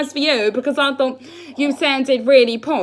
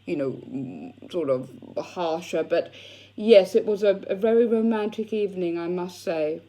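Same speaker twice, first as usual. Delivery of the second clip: put-on posh English accent; lightly posh, trying to sound refined; 5 semitones lower, 5 dB quieter than usual. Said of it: "I was putting on a nice voice for you".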